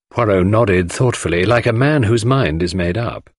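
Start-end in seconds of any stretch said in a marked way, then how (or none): none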